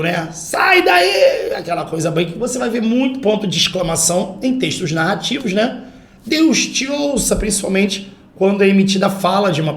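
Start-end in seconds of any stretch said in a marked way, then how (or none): 0.3-1.5 high drawn-out shout on one held note; emphatic command. Shown as a demonstration. Teacher demonstrates the exclamation mark, high emphatic shout. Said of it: "Enfático". 6.2-7.3 high, slow exclamation; emphatic wish. Shown as a demonstration. Teacher voices a character's wish, high emphatic exclamation. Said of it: "Alguma coisa bem enfática".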